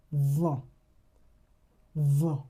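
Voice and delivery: vin with nasal vowel, n silent; French nasal example. Shown as a demonstration. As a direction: model vin twice. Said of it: "You also have".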